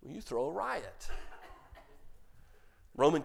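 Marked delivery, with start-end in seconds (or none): none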